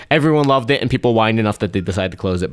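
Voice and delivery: ordinary talk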